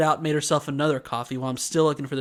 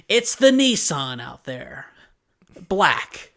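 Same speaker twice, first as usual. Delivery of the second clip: loud, drawn-out impatient answer; hostile customer; 5 dB louder, slower than usual. Retold or imitated. Customer asked what kind of car, hostile. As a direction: imitate her stating the obvious, impatiently. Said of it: "She is hostile with me".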